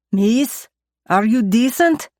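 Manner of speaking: put-on lightly Russian-accented call; thick Russian accent. A narrator's reading. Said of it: "he calls out in a thick Russian accent".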